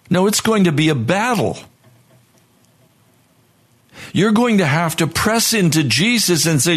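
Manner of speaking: high, urgent preaching at full force; fervent preaching. Acted. Preacher urging the congregation to fight temptation, fervent and high. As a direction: build the sermon to a fervent peak.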